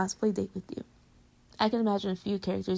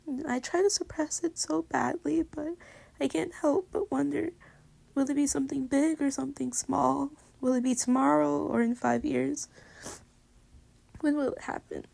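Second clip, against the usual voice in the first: anxious; 5 semitones higher than usual. Real, not acted. Rising anxious worry.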